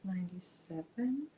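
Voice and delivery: normal talking voice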